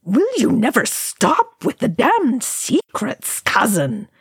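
exasperated, high-pitched, drawn-out outburst; near shriek. A narrator's reading. Balin to his cousin about secrets, nearly shrieking. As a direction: scold with pitch leaping up, stretching the words. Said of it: "Balin nearly shrieked".

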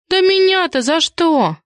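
high, drawn-out squeal of protest. A narrator's reading. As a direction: squeal it indignantly. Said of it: "взвизгнула Киска".

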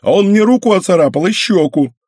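high, loud offended whine; whiny sulky girl. Acted. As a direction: whine it like a wronged kid. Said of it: "проныла та обиженно".

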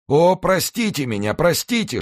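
high, anguished begging; desperate faint pleading. A narrator's reading. Executioner begs the son's forgiveness, high and desperate. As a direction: beg forgiveness in a thin, high voice. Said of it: "шептал он".

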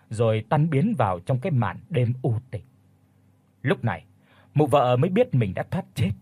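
plain reading voice

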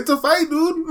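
high-pitched mocking voice; squeaky teasing, breaking into a laugh; B J Penn. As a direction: taunt in a silly high voice. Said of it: "when he was making fun of that guy".

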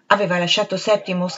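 plain reading voice

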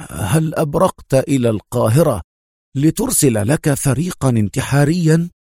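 usual speaking voice

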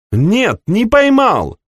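high, clear, defiant cry. Acted. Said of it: "чистый высокий голос".

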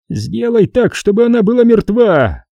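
high, strained villain command; hissed order. A narrator's reading. Xanatos to Bruck, ordering a killing, hissing. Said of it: "прошипел Ксанатос Бруку".

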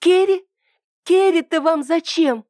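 high, desperate cry. A narrator's reading. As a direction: cry out in despair. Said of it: "отчаянно вскрикнула Ида".

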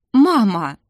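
hurt, loud protest; offended. A narrator's reading. Daughter protesting to her own mother, offended. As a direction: cry it out in wounded reproach. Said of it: "обиженно сказала мамуля".

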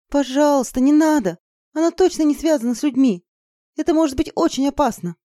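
high-pitched, urgent plea; anxious pleading. Acted. Izergil begging her to skip the rune, anxious. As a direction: beg her not to do it. Pleading against a dangerous step.

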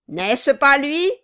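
loud raging snarl, pitch up; furious dwarf. Acted. Quilp yells at the figurehead as he beats it, enraged. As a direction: rage and pound with every word.

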